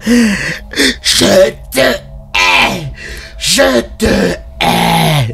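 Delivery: high, hissing hateful curse; hateful witch. Acted. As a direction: spit the hatred out. Burned witch crawls and curses. (sorcière haineuse et brûlée)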